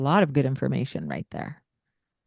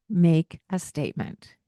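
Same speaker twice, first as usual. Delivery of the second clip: ordinary talk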